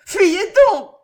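very high, loud cry; shouted. A narrator's reading. Mademoiselle d'Armilly urging Andrea to flee, shouting. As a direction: shout at him to run. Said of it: "cria mademoiselle d'Armilly".

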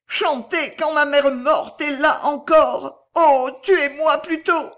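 high, loud, drawn-out anguish; râle d'horreur. A narrator's reading. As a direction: plead slowly in horror. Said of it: "râla-t-elle". (supplication angoissée, aiguë et traînante)

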